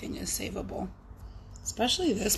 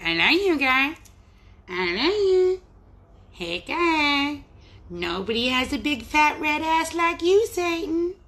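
high, hammed-up South Park accent; pitched-up, imperfect South Park quote accent; 7 semitones higher, 3 dB louder than usual. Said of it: "Not my best accent".

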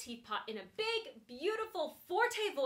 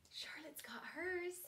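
soft whispered aside; quiet aside about Charlotte; 4 semitones higher, 9 dB quieter than usual. Real, not acted. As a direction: whisper it to the class.

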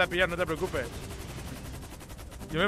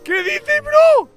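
excited shouting; 11 semitones higher, 10 dB louder, slower than usual. Real, not acted. Planes swarming him in game.

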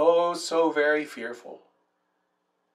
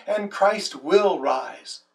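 normal talking voice

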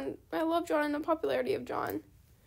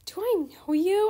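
mock fan question; 4 semitones higher, 5 dB louder, slower than usual. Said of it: "people are like".

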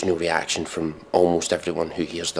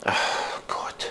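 despairing whisper; 7 dB quieter than usual. Real, not acted. Groaning at song.

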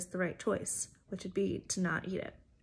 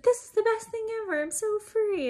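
much higher, rushed, gushing glee; hammed-up elation, pitched way up and louder; dramatic Rapunzel; 13 semitones higher, 4 dB louder than usual. Youth leader acting out Rapunzel running away, giddy mock joy. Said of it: "it's very dramatic".

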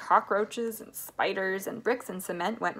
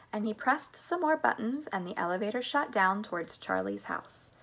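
plain reading voice

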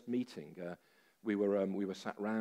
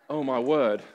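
laughing exclamation; 3 semitones higher, 12 dB louder, slower than usual. Real, not acted. Laughing at his own doubt.

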